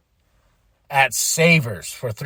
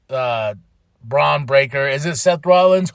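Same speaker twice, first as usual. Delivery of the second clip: usual speaking voice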